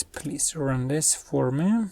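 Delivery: ordinary talk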